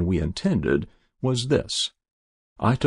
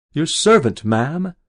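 louder, higher mock courtesy; sneering; 6 semitones higher, 5 dB louder, slower than usual. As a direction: greet her with contempt. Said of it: "He snarled at me".

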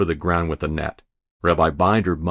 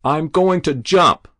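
high, slow, loud announcement; defiant call; 8 semitones higher, 5 dB louder than usual. A narrator's reading. Boy on the roof threatens the crowd below, defiant call. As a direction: call it out from the rooftop.

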